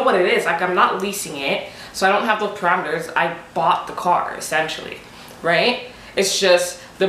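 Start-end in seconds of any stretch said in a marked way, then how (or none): none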